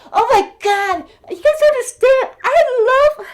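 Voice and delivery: gushing, emotion breaking through loud and high; overwhelmed with emotion. Real, not acted. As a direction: voice jumps up and swells, overcome. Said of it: "I'm gonna get very emotional".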